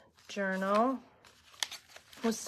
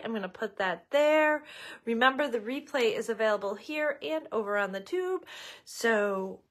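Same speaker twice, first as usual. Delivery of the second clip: ordinary delivery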